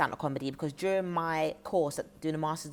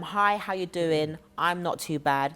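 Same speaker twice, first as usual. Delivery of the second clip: slow; slower than usual. Retold or imitated. Quotes his motto slowly.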